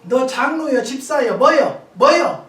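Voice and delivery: loud, mocking demand rising on each word; angry interrogation.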